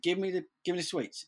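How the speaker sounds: understated, hurried telling-off; retold brisk demand. Older teammate first asking sugar-high kid for sweets, retold brisk demand.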